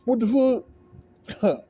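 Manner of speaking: high, drawn-out disbelief; exaggerated bafflement; incredulous. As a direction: stretch it out slowly in high-pitched amazement. Incredulous at brother's reply.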